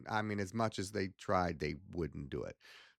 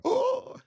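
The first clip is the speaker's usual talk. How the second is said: gasp; 6 dB louder than usual. Retold or imitated. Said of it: "and they go".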